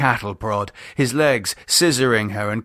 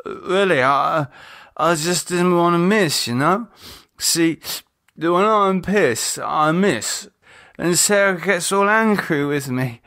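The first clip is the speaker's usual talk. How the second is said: put-on drunk rambling; 8 semitones higher than usual.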